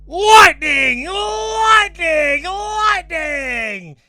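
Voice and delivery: high, full-volume drawn-out yell; wildly overdone high-pitched shouting; shouted lightning call. Acted. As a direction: bellow it like a madman mid-joke. Man in joke shouts weather words.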